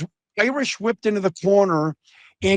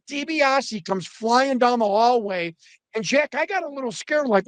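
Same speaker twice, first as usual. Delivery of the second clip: normal talking voice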